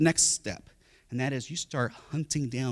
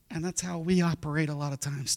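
ordinary talk, nothing marked

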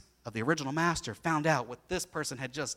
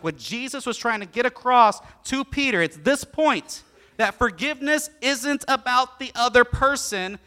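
loud, high emphatic preaching; fervent preaching; 8 semitones higher, 9 dB louder than usual. Acted. Pastor urging the congregation on forgiveness's true point. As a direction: drive the main point home.

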